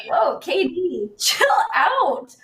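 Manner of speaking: high, laughing mock plea; mock chill-out plea. Asking KD why he's so mad, high and laughing. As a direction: coax him to calm down, laughing. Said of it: "that was like".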